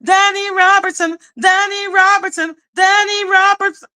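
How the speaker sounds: a high, singsong mock chant of the name; mocking Polly. Mocking Polly, who keeps repeating Danny's name, in a high singsong chant. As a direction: parrot her obsession in a high, nagging repeat. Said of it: "now she's like".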